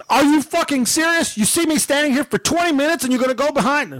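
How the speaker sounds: high-pitched indignant tirade; retold outrage. Customer retelling being skipped in line, outraged. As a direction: replay being pissed off, pitch way up. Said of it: "I was pissed off".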